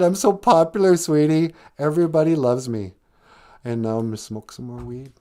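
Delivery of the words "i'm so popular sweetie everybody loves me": higher, louder gushing brag on "so popular"; mock boastful girl. Popular girl showing off to the others, hammed-up gushing. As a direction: gush it like the spoiled popular girl. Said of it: "She goes".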